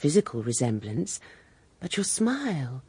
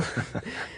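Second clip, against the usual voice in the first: soft breathy laugh; 6 semitones lower, 7 dB quieter than usual. Acted. Mrs Renshaw amused.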